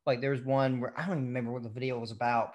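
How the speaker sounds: ordinary talk, nothing marked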